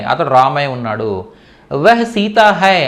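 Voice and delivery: usual speaking voice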